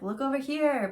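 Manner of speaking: high, loud showman call to look away; magician's patter. Retold or imitated. Magician distracting the crowd, high loud showman call. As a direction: play the old magician steering the eye. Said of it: "like, the old magician where it's like".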